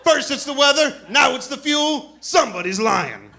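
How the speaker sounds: suspicious lady. Retold or imitated. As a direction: shout it up high like a suspicious passenger. Lady six rows behind suspicious.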